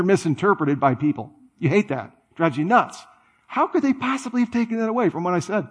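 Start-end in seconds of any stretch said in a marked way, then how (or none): 3.5-5.7 high, fast incredulous complaint; mock exasperation. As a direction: protest in disbelief at being misread. Acting out someone upset at being misinterpreted.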